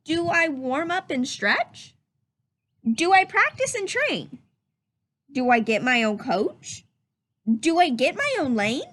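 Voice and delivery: lively, curious rising questions; questioning read-aloud voice. Shown as a demonstration. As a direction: read each question like a wondering child. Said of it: "notice how I change my voice when I read a question".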